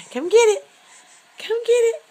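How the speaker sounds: high, loud sing-song coaxing. Real, not acted. Coaxing baby to crawl.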